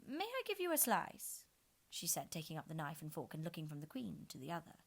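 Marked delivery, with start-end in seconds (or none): 0.1-1.4 put-on high, polite girlish question; timid Alice. A narrator's reading. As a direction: offer shyly as young Alice.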